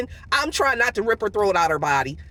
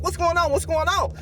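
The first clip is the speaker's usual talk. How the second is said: higher-pitched imitation of a manager calling out; alarmed manager; 7 semitones higher than usual. Manager running out to the commotion, mildly alarmed. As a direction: lightly mimic a boss rushing in. Manager runs out, retold again.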